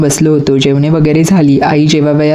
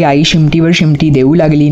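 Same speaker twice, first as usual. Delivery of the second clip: ordinary delivery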